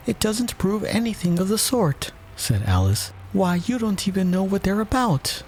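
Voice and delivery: put-on high indignant girl's voice; a girl protesting sharply; Alice, indignant. A narrator's reading.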